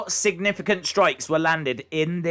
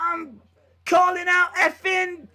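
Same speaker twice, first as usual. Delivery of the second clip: mock Vettori yell; 12 semitones higher than usual. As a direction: hype it up, way over the top. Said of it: "he was like".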